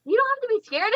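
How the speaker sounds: can't believe what she just watched; amazed friend. Retold or imitated. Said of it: "she's like".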